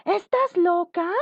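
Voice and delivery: ¿Estás loca? alto y lento, asombrada; ¿Estás loca? incrédula. Shown as a demonstration. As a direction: exclamar incrédula, subiendo mucho. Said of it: "y después puede ir una pregunta". (pregunta exclamada con asombro)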